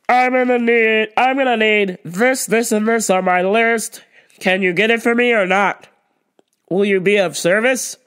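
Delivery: loud, demanding customer call; imitating the pushy shopper demanding service, loud; entitled customer. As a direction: snap for attention like you're owed it. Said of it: "And she's yelling".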